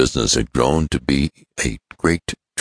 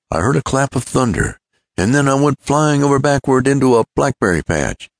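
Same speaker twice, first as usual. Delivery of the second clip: animated chum telling his mishap, higher; lightly acted tall tale, building in pitch; Ned; 8 semitones higher than usual. Ned tells shotgun anecdote.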